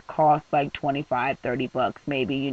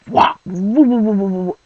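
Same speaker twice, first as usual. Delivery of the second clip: imitated tire flapping noise; 4 semitones higher, 7 dB louder than usual.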